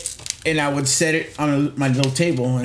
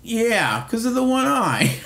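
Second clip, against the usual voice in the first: laughing agreement; 8 semitones higher, slower than usual. Retold or imitated. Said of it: "And I'm like".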